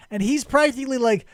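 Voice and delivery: ordinary talk, nothing marked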